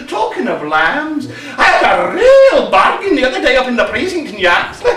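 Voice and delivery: ordinary delivery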